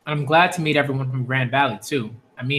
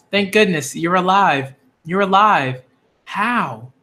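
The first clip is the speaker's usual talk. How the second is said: usual speaking voice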